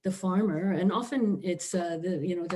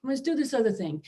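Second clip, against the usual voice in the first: high, mocking lecture to primitive people; mock condescension; 5 semitones higher than usual. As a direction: sneer it out like a patronising official, pitched up. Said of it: "they came along and said".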